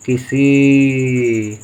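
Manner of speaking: किसी drawn out very slowly, syllable by syllable; stretched dictation. Shown as a demonstration. Teacher dictating किसी to pupils, drawn out slowly. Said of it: "teacher sounds out word".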